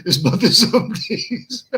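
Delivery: reading the comment through laughter; cracking up. Real, not acted. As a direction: read it and lose it to the giggles.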